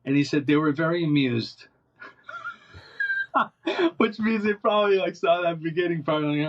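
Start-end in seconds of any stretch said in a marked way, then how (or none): 3.0-3.9 high-pitched burst of laughter; real laugh. Real, not acted. Amused at his own story.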